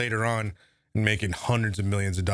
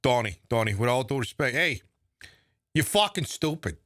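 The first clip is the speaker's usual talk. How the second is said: put-on Boston accent; 5 semitones higher than usual. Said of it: "guy from Boston".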